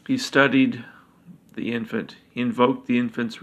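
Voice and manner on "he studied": plain reading voice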